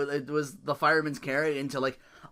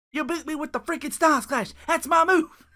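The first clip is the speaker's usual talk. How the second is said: high, laughing put-on wrestler voice; mock wrestler complaint; 11 semitones higher, 4 dB louder than usual. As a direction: whine like a robbed wrestler, laughing.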